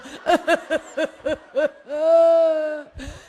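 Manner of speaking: loud, hammed-up staccato sobs into a wail; mock sobbing. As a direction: sob theatrically in bursts, ending on a long moan. Hammed-up sobbing inside the song.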